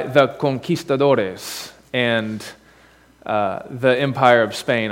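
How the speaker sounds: conquistadores said slowly with Spanish pronunciation; a careful Spanish accent on conquistadores. Real, not acted. Preacher naming Cortez's soldiers to the congregation, in a Spanish accent. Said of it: "say it in a pretentious Spanish accent".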